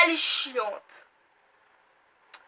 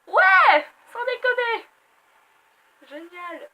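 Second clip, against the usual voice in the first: mock excitement; 7 semitones higher, 11 dB louder, slower than usual. Real, not acted. Sarcastic cheer about the sequel.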